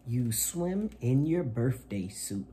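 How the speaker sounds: bright, lively picture-book reading voice; reading to children. Acted. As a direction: read it to little kids with gusto. Reads picture book aloud.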